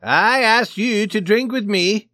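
loud, high, drawn-out complaint; blustering, angry Swede. A narrator's reading. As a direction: insist angrily at full voice. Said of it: "he asked angrily".

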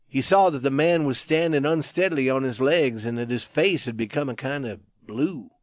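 ordinary delivery

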